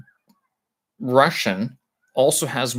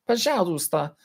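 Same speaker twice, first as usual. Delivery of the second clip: imitating Google Translate; 9 semitones higher than usual. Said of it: "says Google Translate".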